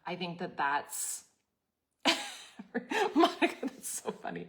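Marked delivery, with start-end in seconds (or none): 2.0-4.5 high bursting laugh through the words; real laughter. Real, not acted. Host laughing at viewer Monica's funny comment.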